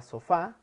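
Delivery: sofá, weight landing on the closing á; stress on final syllable. Shown as a demonstration. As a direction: hit the last syllable of sofá. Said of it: "que lleva su acento en la A".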